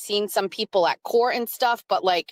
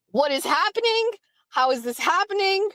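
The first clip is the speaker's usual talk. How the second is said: what is happening pitched much higher, incredulous; rising disbelief; 9 semitones higher than usual. Retold or imitated. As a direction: play the mounting shock of the moment. Stunned by fan encounter.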